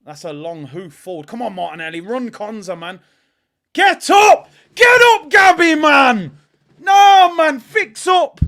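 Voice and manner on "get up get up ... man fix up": slow, stretched angry bellow; furious shout. Real, not acted. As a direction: yell it at the screen at full force, high and loud. Yelling at players on screen.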